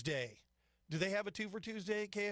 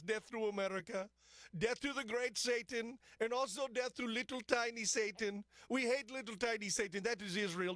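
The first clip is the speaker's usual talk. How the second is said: higher, hammed-up Iranian accent; Pezeshkian in mock Iranian accent; 6 semitones higher than usual. Radio host lampooning Iran's leader chanting death threats, in a mock Iranian accent. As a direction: play a comic tyrant with a high mock Iranian accent. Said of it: "if he actually speaks in that accent".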